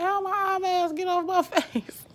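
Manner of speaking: high loud startled cry, half laughing. Retold or imitated. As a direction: blurt it out, shocked and laughing. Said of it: "I'm like".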